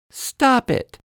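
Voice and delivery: high little-girl voice, a mild mock shout; high childish "Stop it" with a firm drop; girl scolding. Lizzie telling Parrot off in a high girl's voice. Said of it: "Lizzie shouts at Parrot".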